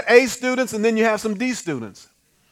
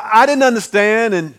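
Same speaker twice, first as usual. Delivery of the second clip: normal talking voice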